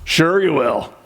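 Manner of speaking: slow, sneering "Ugh, sure you will"; a slow sneer of disbelief at a partner; scoffing. Retold or imitated. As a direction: scoff at them, drawn out and disbelieving.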